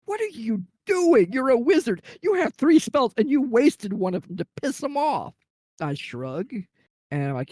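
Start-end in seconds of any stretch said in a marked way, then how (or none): 0.1-5.3 incredulous outcry. Retold or imitated. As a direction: ham up the whole table's shocked disbelief. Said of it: "Everybody's like".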